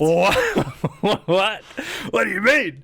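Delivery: high, laughing 'what?'; laughing disbelief. Retold or imitated. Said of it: "I was like".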